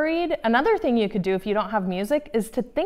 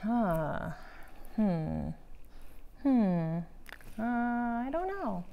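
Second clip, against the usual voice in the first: puzzled; 9 dB quieter, slower than usual. Shown as a demonstration. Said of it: "My confused face is like".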